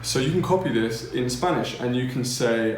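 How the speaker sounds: ordinary talk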